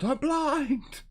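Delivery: high, loud panicked-villager yell; loud, high-pitched acted shout. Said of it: "people shouting out".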